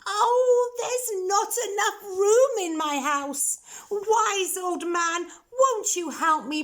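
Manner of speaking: put-on high, pleading old lady voice; old lady begging for help; grumbling old lady. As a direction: beg the wise man for help, high. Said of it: "heard her grumble and grouse".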